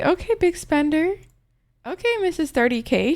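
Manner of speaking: high teasing voice. Real, not acted. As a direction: tease her lightly. Teasing a viewer.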